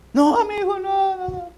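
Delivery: very high pleading cries; imitating a begging martyr. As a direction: beg desperately in a high put-on voice. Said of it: "Iban gritando así". (imitando súplicas de un mártir, voz muy aguda)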